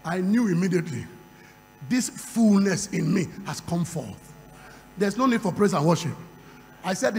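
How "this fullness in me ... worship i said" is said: ordinary talk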